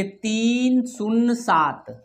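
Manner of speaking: slow, separated digits; digit-by-digit dictation. Shown as a demonstration. Teacher reading digits after the decimal, slow dictation. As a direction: draw out each digit, then leave long pauses. अंक पढ़ेंगे.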